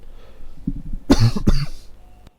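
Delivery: choking cough on a sip; sputtering cough after drinking. Real, not acted. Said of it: "trying to talk and drink whilst almost laying down".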